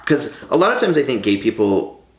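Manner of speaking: ordinary delivery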